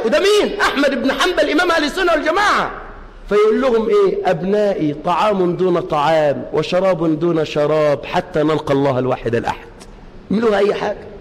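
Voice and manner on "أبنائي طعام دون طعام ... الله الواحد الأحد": calm fatherly reply. Retold or imitated. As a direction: gently settle his children. Imam's calm reply to children.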